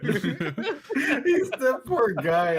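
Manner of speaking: high, slow words through laughing; squeaky, laugh-choked sympathy; real helpless laughter. Real, not acted. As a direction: crack up while pitying him. Laughing at retold story.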